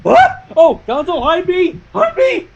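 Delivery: half-joking frightened plea; mock panic. Real, not acted. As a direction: squeak for cover in mock terror. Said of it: "I'm getting scared now".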